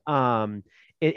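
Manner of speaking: normal talking voice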